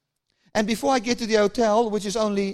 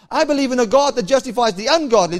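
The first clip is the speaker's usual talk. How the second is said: preached declaration; 3 semitones higher, 5 dB louder than usual. Acted. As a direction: proclaim it to the congregation. Same line now preached aloud.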